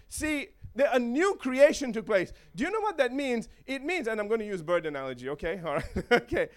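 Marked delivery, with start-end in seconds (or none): none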